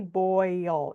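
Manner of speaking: "boil" stretched slowly so the oi glides; drawn-out diphthong. Shown as a demonstration. Teacher letting students hear the glide, "boil" drawn out on oi. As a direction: say "boil" slowly, sliding through both vowel sounds. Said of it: "you hear that oi".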